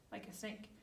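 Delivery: usual speaking voice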